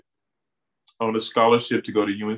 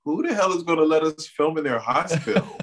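higher-pitched, mock disbelief at the idea; incredulous inner voice; 6 semitones higher, faster than usual. Remembering doubts about finding a hospital, incredulous. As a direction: toss it off like a ridiculous question to yourself. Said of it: "So I was like".

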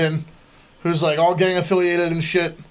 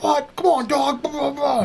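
higher, whiny pleading voice; pleading street guy; 8 semitones higher, slower than usual. Retold or imitated. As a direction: whine it like a guy begging to get in. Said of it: "he'd just be like".

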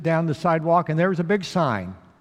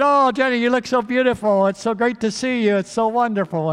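high, louder delighted greeting; shower her with happy praise; fiancé greeting his late bride-to-be with joy; gushing; 7 semitones higher, 5 dB louder than usual. Acted. James gushing over late fiancée.

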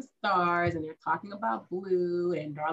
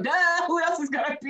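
mock duh; 10 semitones higher, 4 dB louder than usual. Sarcastic retort in her head.

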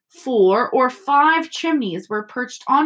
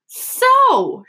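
high, loud, drawn-out "so"; angry confrontation; 5 dB louder than usual. A narrator's reading. Mother confronting her guilty sons, angrily. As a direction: pounce on the guilty with one high word.

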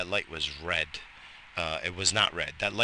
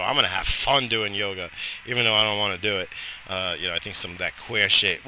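normal talking voice

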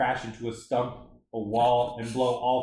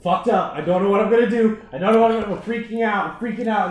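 loud, higher, frantic mock panic; 7 semitones higher, 7 dB louder than usual. Friend retelling his own panic, mock frantic and loud. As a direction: spiral into a hammed-up freak-out, louder and higher. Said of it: "I go".

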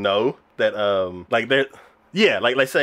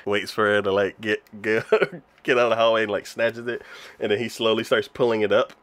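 ordinary talk

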